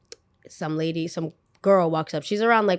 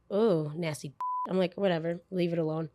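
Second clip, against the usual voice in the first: muttered disgust; 3 semitones lower, 9 dB quieter than usual. Retold or imitated.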